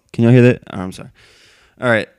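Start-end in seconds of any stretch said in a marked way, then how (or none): 0.1-0.6 sudden loud alarmed call to the audience; startled loud question. Real, not acted. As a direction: blurt it out, caught off guard. Mic suddenly yanked out, startled.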